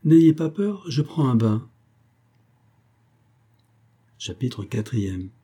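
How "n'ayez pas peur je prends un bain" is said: higher and louder, a lightly voiced count; light, amused reassurance; reassuring count. A narrator's reading. Count reassuring the startled baroness, lightly voiced.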